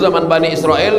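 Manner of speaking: ordinary delivery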